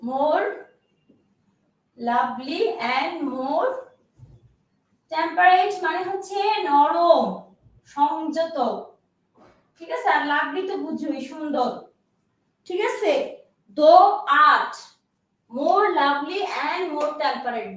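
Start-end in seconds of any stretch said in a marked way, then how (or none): 0.0-5.7 very slow, words pulled apart; slow dictation. Shown as a demonstration. Repeating dictation. 13.8-17.7 drawn-out, very slow and deliberate; very slow dictation to students. Shown as a demonstration. Repeating dictation line.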